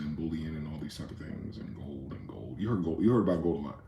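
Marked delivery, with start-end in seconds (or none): 0.0-2.6 hushed unvoiced whisper. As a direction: drop to a secretive whisper. Secretive aside about gold.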